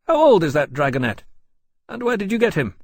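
high, loud interrogation; brusque courier captain. A narrator's reading. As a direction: demand answers in a high, forceful voice. James questions Laurence, frowning.

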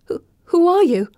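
high, loud stammering question; frightened. A narrator's reading. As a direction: ask in breathless terror. Frightened girl asks gargoyle.

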